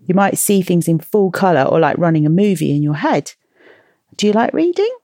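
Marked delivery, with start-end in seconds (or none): 4.2-4.9 high playful question. Acted. Podcast host asks kids playfully.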